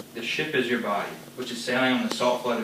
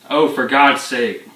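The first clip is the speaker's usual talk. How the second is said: angry father; 5 dB louder than usual. Acted. Father shouting down his wife, furious and loud. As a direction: roar down the wife who dares interrupt. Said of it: "Honey, you're getting too mad".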